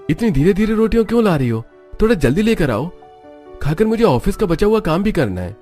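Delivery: high-pitched, hurrying complaint; impatient demand. Acted. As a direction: hurry the cook along, pitched well up. Impatient man demanding rotis. (झुंझलाकर जल्दी मचाते हुए)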